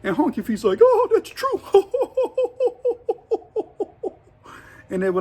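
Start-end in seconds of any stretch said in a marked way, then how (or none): 0.8-1.6 high put-on voice with a laugh; laughing agreement. Honky Feet laughing at the joke, high voice. 1.7-4.1 high cackle. Retold or imitated. Honky Feet cackling at the honky face joke.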